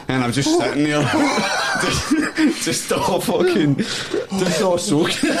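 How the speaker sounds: slow, high, laughing words. Real, not acted. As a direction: drag it out through laughter. Laughing fit.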